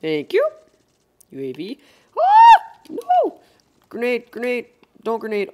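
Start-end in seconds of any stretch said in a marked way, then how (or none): 2.2-3.4 high, loud alarmed shriek; panicked scream. Real, not acted. Sudden grenade threat. 3.9-5.5 loud, urgent warning shout; grenade warning yell. Real, not acted. Grenade incoming in game.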